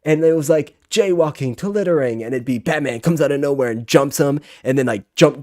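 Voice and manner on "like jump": ordinary talk, nothing marked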